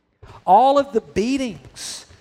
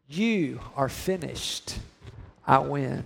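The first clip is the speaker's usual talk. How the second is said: normal talking voice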